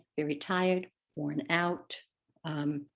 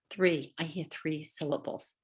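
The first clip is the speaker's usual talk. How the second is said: normal talking voice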